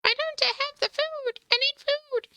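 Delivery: high, sing-song welcome; squeaky cheerful thank you; cutesy greeting voice. Acted. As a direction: coo at your viewers.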